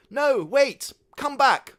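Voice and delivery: very high, loud plea; calling after. Acted. Father shouting after his departing son. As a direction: shout after him in panic.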